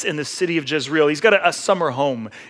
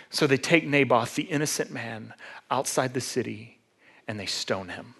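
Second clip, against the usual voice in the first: low, softened somber narration; grave hush; 5 semitones lower, 9 dB quieter than usual. A narrator's reading. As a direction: drop low and quiet for the tragedy. Grave telling of an innocent man's death.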